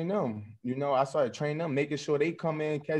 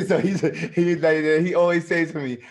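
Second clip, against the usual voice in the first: laughing; 3 semitones higher, 9 dB louder than usual. Real, not acted. Excited retelling with laughter.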